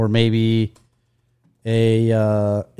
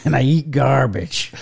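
Danny DeVito impression. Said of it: "Uh, Danny DeVito".

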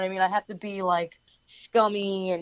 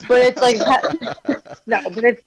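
laughing; 3 semitones higher, 4 dB louder, slower than usual. Real, not acted. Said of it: "no, I'm kidding".

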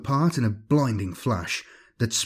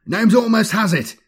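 high, loud urgent yell; shouted; 11 semitones higher, 6 dB louder than usual. A narrator's reading. Egil urging comrades to hold on, shouting. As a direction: call it out to comrades under attack. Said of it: "shouted Egil".

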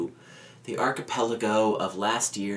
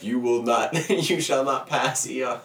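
mock grand whale voice; 3 semitones higher, 6 dB louder than usual. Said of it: "he's like".

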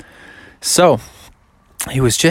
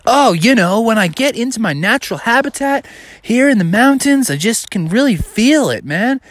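higher-pitched, earnest bear voice; hammed-up humble performer, pitched up; soulful artist bear; 7 semitones higher than usual. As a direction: play a mellow artist feeling the moment. Bear answers praise of his singing.

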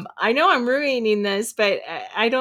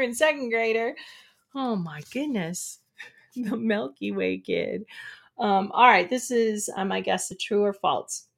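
usual speaking voice

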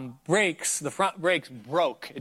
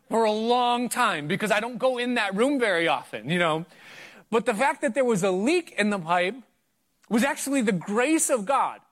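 raised, slowed preacher's point; preaching emphasis; 4 semitones higher than usual. Acted. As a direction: drive the point home to the congregation.

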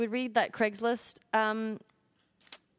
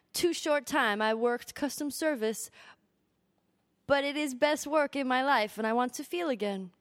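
put-on Korean accent. Co-host reading a job seeker's letter, mock Korean accent. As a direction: read it out in an attempted Korean accent. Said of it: "I can't do a Korean accent".

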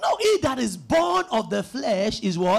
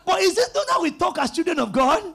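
normal talking voice

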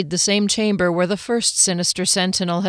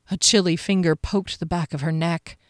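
plain reading voice

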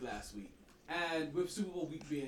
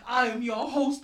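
ordinary talk, nothing marked

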